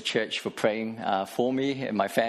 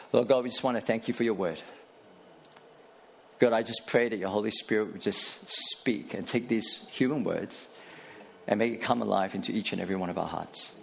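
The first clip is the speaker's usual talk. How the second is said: prayer. Acted. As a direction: pray over the room, gently and sincerely. Pastor prays over congregation.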